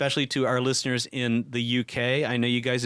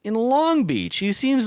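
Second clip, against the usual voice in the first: surprised; 11 semitones higher, 3 dB louder, slower than usual. Real, not acted. Surprised at lookup result.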